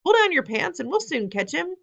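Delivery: high shouted advice; shouting. A narrator's reading. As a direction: holler it across the kitchen. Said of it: "someone else shouted".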